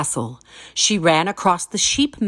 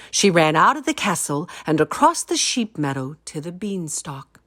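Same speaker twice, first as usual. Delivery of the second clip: usual speaking voice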